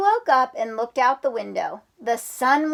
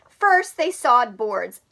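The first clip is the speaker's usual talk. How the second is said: plain reading voice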